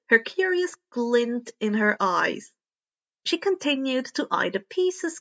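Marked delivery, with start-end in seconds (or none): none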